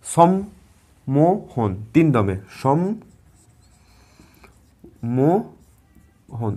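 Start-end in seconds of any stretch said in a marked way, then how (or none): 0.0-1.8 সম্মোহন drawn out slowly in three separate beats; সম্মোহন spoken as sɔm-mo-hon, one beat at a time; syllable by syllable. Shown as a demonstration. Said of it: "তিন দমে উচ্চারণ করছি". 2.5-6.5 সম্মো- হন broken with a long gap; syllables of সম্মোহন pulled apart; syllable split. Shown as a demonstration. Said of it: "তিন দমে উচ্চারণ করছি".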